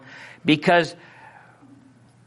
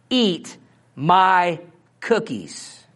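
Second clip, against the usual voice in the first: drawn out, each word weighed; slow it down like a parent laying down the law; slow stern warning; much slower than usual. Retold or imitated.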